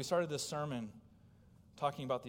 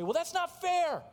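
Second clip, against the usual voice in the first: much higher and louder whine; whiny complaint; 8 dB louder than usual. Retold or imitated. Preacher voicing listeners who object, high whining. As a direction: protest like a sulking child. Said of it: "they'll say".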